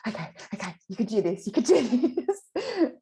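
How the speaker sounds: mild retold nerves, laughing at the end; nervous self pep talk. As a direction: psych yourself up before a hill confession. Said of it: "I was like".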